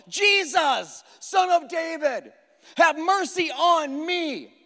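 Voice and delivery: high, slow, pleading cry of a blind beggar. A narrator's reading. Blind beggar crying out to Jesus for mercy, high pleading call. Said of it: "Hence crying out".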